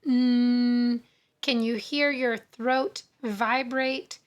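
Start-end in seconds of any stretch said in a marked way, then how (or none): 0.0-1.0 loud, steady held "mm"; voiced hum. Shown as a demonstration. Teacher demonstrating a voiced sound, held hum. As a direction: hum "mm" so the throat vibrates. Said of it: "ends with a voiced sound".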